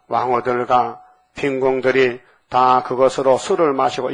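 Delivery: slow, measured Bible recitation; deliberate pulpit reading with weighted words; scripture reading aloud. Acted. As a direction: read the verse out firmly, phrase by phrase. Resumes reading the scripture verse.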